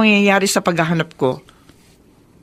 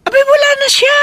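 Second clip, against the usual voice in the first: much higher, louder alarmed shout; panicked cry; 4 dB louder than usual. Acted. Mother panics on phone. (nagpapanic na sigaw)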